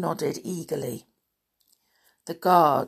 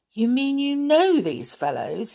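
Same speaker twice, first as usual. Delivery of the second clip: higher, incredulous question; surprised; 6 semitones higher than usual. A narrator's reading. Guard asks the princess about the dwarfs, surprised. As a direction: rise in disbelief. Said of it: "The guard looked surprised".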